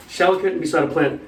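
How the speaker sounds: "Shower curtain beside a plant" said quickly as one word; run together. Shown as a demonstration. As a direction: join "Shower curtain beside a plant" into a single compound, no gaps. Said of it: "That becomes one word in German".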